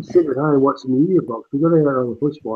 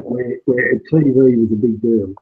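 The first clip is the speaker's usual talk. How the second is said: normal talking voice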